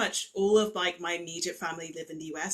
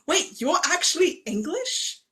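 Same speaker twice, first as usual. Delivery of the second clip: loud, high, drawn-out disbelief; incredulous friend; 6 semitones higher, 6 dB louder, slower than usual. Retold or imitated. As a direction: imitate an astonished questioner, raised and slow. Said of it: "who then went".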